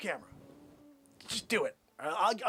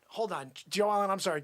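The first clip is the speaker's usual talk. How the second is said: ordinary delivery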